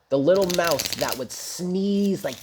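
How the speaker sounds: ordinary delivery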